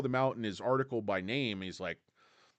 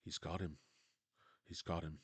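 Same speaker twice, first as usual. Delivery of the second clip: low hushed murmur, much softer; hushed chess coach; 9 semitones lower, 12 dB quieter than usual. Retold or imitated. Coach watching the chess game on TV, hushed and intent. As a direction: breathe it as if not to break his focus. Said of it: "And he's like".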